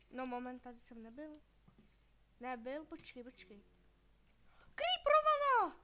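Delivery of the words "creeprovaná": high, loud, slow drawn-out shout; loud stretched alarmed cry. Real, not acted. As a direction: yell the warning in alarm. Creeper appears.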